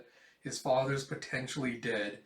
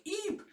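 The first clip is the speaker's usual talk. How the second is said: high squeak; 3 dB quieter than usual. Acted. Child squeals at blast.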